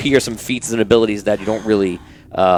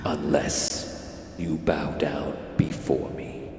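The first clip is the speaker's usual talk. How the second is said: slow, low, soft alien hiss; menacing hissing monster; hissy voice; 5 semitones lower, 10 dB quieter, slower than usual. Acted. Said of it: "hissy voice with reverb".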